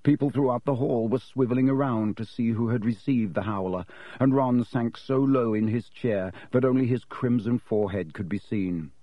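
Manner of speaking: usual speaking voice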